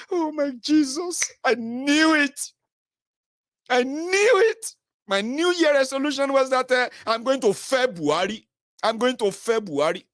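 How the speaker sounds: high, slow, triumphant voice; mock elation. A supporter mocking gloating believers, high and drawn out. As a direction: gloat as if proven right.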